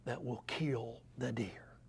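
grandson's line whispered low and slow; hushed whisper. Retold or imitated. Grandson showing the deer arrow to his grandfather, whispered. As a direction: breathe it like a hunter in the woods. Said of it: "He said".

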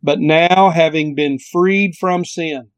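slow, measured scripture reading. Shown as a demonstration. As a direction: read the verse out slowly. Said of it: "I'll read it slowly".

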